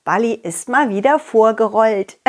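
usual speaking voice

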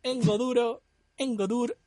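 sing-song fake Italian accent; mock Italian accent. Reading the Italian dub to friends, hammed-up Italian accent. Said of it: "tengo que leerte el italiano".

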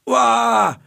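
high, loud groan of disgust; a long annoyed "uah" of contempt. Real, not acted. Fed up with TV brides, she groans in disgust.

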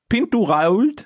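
Pintu Rault with every letter sounded, pitched high; read as written. Shown as a demonstration. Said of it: "einfach nur Vorlesen wie".

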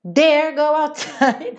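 high, slow, hammed-up scolding; very angry threat. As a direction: stretch "dare" in mock outrage. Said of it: "aquela ideia de tá muito bravo".

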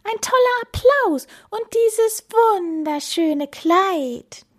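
very high, squeaky starstruck voice; excitable penguin. Acted. Penguin tempting Bella with singing and dancing, excitable. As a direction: gush at top pitch, stretching the ending.

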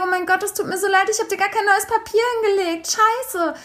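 höher und schnell, aufgeregt entschuldigend; nachgespielte Panik. Retold or imitated. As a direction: hastig und höher, wie erschrocken über sich selbst. Zitiert eigene Panik. (zitiert sich selbst beim Entschuldigen, höher und schnell)

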